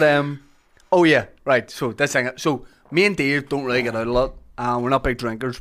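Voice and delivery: ordinary delivery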